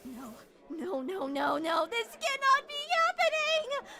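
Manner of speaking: wailing in despair; crying. Acted. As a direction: sob out the denial, rising high. Heroine despairs over the gone pizza.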